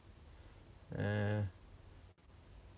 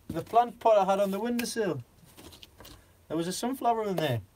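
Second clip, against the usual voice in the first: mock wife; 8 semitones higher, 4 dB louder than usual. Retelling wife's complaint to husband.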